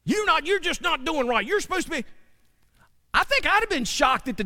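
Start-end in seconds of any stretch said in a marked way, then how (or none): 0.0-2.0 a higher, lightly voiced scolding Balaam. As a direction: scold the donkey mildly. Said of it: "Balaam answers him".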